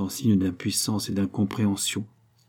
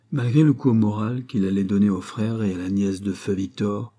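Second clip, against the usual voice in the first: ordinary delivery, nothing marked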